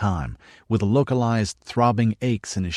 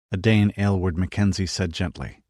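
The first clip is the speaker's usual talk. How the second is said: ordinary delivery, nothing marked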